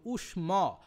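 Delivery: উষ্ম with a clear M, not N; corrective clarity. Shown as a demonstration. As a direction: set উষ্ম apart, sounding its final M. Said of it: "উষ্ণ নয় কিন্তু".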